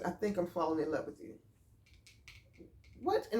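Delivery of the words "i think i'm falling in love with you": low, hushed confession; softly voiced retold love confession; imitated whisper. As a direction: murmur it softly, as if into an ear. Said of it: "he whispered in my ear".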